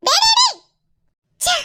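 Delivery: high, loud exasperated calling out; frustrated yell. Acted. Wife yelling after her husband as he leaves.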